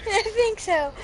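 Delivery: high giggly answer; giggly reply. Real, not acted. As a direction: answer still laughing.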